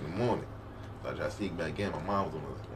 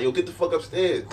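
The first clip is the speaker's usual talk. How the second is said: loud, raised mock yell up high; loud retold yell; 8 semitones higher, 5 dB louder than usual. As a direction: call out down the stairs, half laughing. Yelling at girl in basement.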